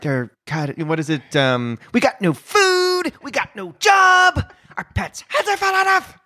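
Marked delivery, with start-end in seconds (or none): 1.9-6.2 much higher, slow, wailing cry of a song; hammed-up sobbing yell, pushed up high and dragged out; a comic actor howling a sad song through fake tears; Jim Carrey impression. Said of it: "more Jim Carrey impressions".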